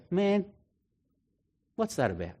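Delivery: eye-rolling exasperation. Retold or imitated. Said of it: "I roll my eyes at and I go".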